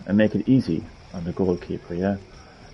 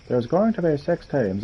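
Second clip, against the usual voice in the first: attempted German accent, higher pitched; failed German accent; 6 semitones higher, 4 dB quieter than usual. Voicing game dialogue about the teams, failed German accent. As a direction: put on an unconvincing German accent. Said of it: "That's not a German accent".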